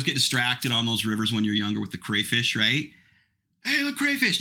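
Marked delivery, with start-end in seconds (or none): 3.6-4.4 put-on excited kid. Kids spotting crayfish at river.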